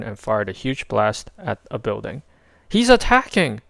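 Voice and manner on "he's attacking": higher, louder cry of alarm; alarmed shout. A narrator's reading. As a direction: sound the alarm.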